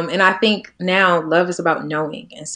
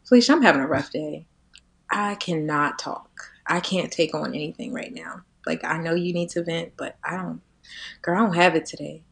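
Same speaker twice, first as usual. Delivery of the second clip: toned-down voice; 7 dB quieter than usual. Shown as a demonstration. Telling her sister she can't talk today, toned down. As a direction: bring it down, gently and softly. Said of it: "It's the tone. It's coming down".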